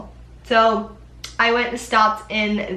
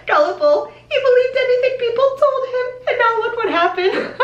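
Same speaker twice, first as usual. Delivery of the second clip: much higher, hammed-up fake crying; mock sobbing; 4 dB louder than usual. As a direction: wail it through a laughing fake sob. Mock sob over the dwarf story.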